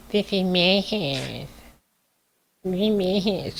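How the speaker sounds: high, slow whiny toddler imitation. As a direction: whine it like a spoiled toddler. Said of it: "Sound like a toddler".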